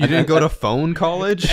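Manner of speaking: high, slow incredulous question; hammed-up rookie roasting; mock disbelief. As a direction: stretch it out, acting appalled to tease. Acting out coworkers roasting him.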